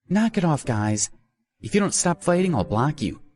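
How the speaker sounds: put-on high, annoyed girlish warning; scowling scold. A narrator's reading. Streamer warning fighting viewers, annoyed scolding. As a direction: scold them sharply in a high voice. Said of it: "Minnie scowled".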